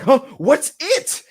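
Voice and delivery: high-pitched confused fan; mock bafflement. Imitated viewer hearing a cryptic message, baffled. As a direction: blurt it out, puzzled. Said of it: "And you're like".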